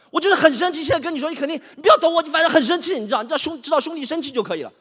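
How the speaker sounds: worked-up rant, high and loud; high-pitched, indignant tirade; very angry. Acted. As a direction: vent furiously at a friend.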